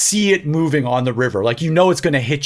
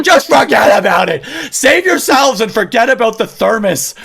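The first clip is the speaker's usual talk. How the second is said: loud, higher, urgent call; retold shout; 5 semitones higher, 4 dB louder than usual. Calling to family chasing floating gear, mock-desperate shout. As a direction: shout it across the river to save them. Acted shout amid canoe disaster.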